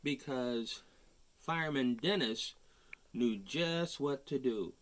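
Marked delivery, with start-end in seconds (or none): none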